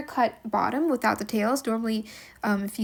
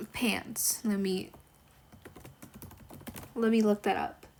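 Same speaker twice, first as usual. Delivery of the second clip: usual speaking voice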